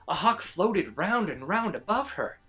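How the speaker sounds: ordinary delivery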